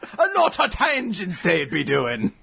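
slow, shouted pirate voice; Sasaki-san. Acted. Complaining about the hosts' tangents, pirate impression. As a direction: belt it out as a hammed-up pirate. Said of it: "He's a pirate".

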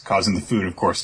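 normal talking voice

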